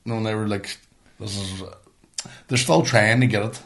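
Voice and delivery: normal talking voice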